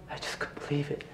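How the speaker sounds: a whisper, barely any breath left; breathless whisper. Real, not acted. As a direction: say it to yourself in disbelief. Stunned after escaping home.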